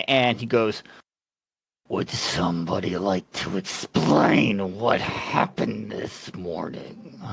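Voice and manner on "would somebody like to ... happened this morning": low, slow, menacing question from a towering creature; transformed giant questioning the party; looming monster voice. Acted. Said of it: "ten-foot figure ... in this transformed form".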